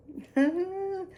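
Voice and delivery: high excited giggle; nervous laugh. Real, not acted. Nervous about dyeing the wig, high giggle. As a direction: laugh off the nerves.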